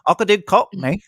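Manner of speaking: hammed-up Cockney. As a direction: put on a jokey Cockney accent. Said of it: "It's Cockney".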